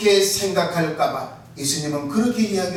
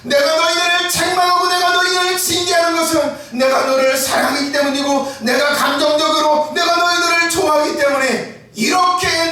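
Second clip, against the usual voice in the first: Jesus declaring love; 8 semitones higher than usual. Acted. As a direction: proclaim it with full force. Jesus voiced, shouting.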